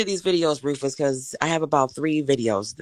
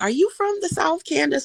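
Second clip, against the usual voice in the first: quoting surprised people; 11 semitones higher than usual. Retold or imitated. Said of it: "They're like".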